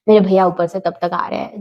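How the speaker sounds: ordinary talk, nothing marked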